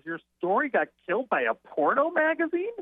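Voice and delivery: high-pitched incredulous comic question; Gilbert Gottfried. Retold or imitated. Comedian mocking the writer's rejection, worst imitation. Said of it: "I will do the worst imitation".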